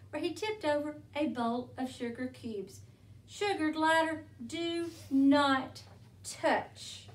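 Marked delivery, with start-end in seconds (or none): 3.3-7.1 firm, louder order with weighted words; stern warning. A narrator's reading. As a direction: warn the little one off, word by word. Wombat warns Sugar Glider off sugar.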